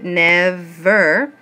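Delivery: "never" split slowly into two even beats, NEV-VER; syllable by syllable. Shown as a demonstration. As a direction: sound out "never" one syllable at a time. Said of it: "is two syllables".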